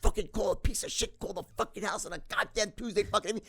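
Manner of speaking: string curses together like art; chained cursing. Retold or imitated. Son imitating dad's lyrical cursing rant. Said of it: "could tie his curses together".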